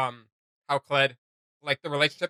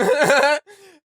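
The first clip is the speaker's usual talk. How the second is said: high burst of laughter; 12 semitones higher, 11 dB louder than usual. Real, not acted. Laughing at own comparison.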